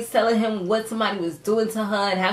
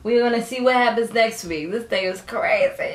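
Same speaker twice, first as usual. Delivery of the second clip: ordinary talk, nothing marked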